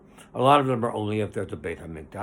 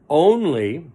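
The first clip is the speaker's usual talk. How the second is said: "Only" said much louder and higher, rising; emphasized "Only"; 6 semitones higher, 11 dB louder than usual. Real, not acted. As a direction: punch the word "Only" with a high rising lift. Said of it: "and I would emphasize the word only".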